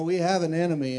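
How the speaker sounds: normal talking voice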